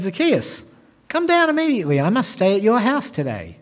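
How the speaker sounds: high-pitched call to someone above. Acted. Jesus calls to Zacchaeus up the tree, calling out. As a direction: call out brightly, pitch leaping on the command.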